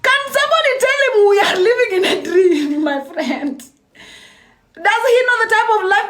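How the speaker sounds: laughing in disbelief; amused, disbelieving retort. Real, not acted. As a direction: laugh while tossing back his words. Laughing at the man's claim.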